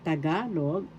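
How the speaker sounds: "Tagalog" with the accent on "ga"; stress on second syllable. Shown as a demonstration. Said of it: "the second syllable ga is where the accent is".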